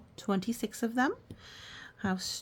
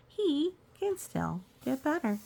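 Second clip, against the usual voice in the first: usual speaking voice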